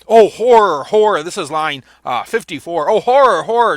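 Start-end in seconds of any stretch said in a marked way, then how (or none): 0.1-1.2 loud, stricken cry of horror; anguished outcry. Acted. Macduff discovers the murdered king. 2.9-3.8 loud, stricken cry of horror on both words; anguished outcry. Acted. Teacher rereads Macduff's outcry.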